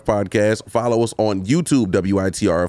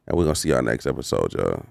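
low, soft sultry voice; sexy voice; 9 semitones lower, 4 dB quieter than usual. Real, not acted. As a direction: drop into a seductive low voice. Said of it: "how sexy my voice got right there".

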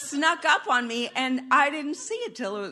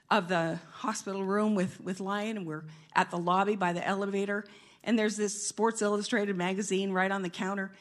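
ordinary delivery

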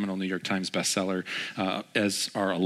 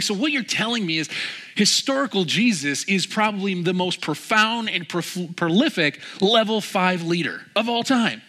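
grandly excited; 10 semitones higher, 5 dB louder than usual. Retold or imitated. Man sums up Jim's answer back to him, grandly excited. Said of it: "very demonstrative person and super entertaining".

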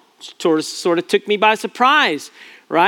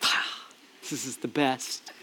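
much softer, laughing mock sigh; whispered tease; 5 semitones lower, 13 dB quieter than usual. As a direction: murmur the son's gloating under your breath. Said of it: "Mitchell's going".

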